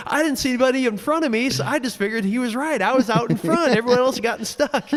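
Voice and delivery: high, earnest, rushed; racer explaining. Retold or imitated. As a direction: tell it high and quick. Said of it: "he goes".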